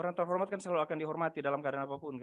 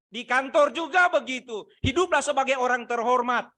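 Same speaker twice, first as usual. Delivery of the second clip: loud, high, fervent sermon delivery; full-force preaching; 9 semitones higher, 9 dB louder than usual. Acted. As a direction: preach it out to the whole church. Preacher rising again.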